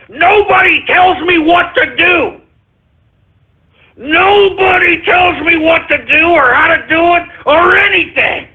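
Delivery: defiant rant. Acted. Ranting host defies listeners.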